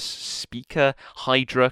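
ordinary talk

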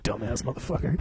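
low, soft grumbled insult; dad grumbling at his kid; dad muttering. Retold or imitated. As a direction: mutter it under your breath. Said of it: "My dad's like".

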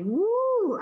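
high joyful woo; joyful whoop. Retold or imitated. Letitia cheering after the officer lets them through, joyful whoop. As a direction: whoop with relief. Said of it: "shouting for joy".

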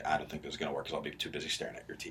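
low, soft, quick aside; quiet, fast imagined excuse; muttered objection. Retold or imitated. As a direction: mutter it quickly under your breath. Quoting his own inner objection.

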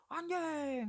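high, drawn-out mock awe; over-the-top gushing. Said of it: "nggak usah yang lebay kayak".

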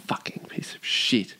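low whispered swearing; muttered curse. Real, not acted. Host cursing a stubborn fan, muttered. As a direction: curse it under your breath.

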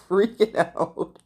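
high, drawn-out panicky voice; slow, hammed-up panic; mock freak-out. Vlogger retelling her panic under the cap, freaking out.

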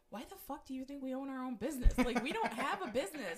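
loud incredulous rant; incredulous outburst. Retold or imitated. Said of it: "I'm like".

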